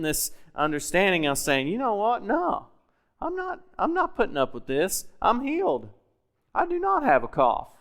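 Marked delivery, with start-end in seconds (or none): 1.7-7.7 much higher, fierce declaration of healing; defiant declaration. Retold or imitated. Preacher acting out his defiant declaration.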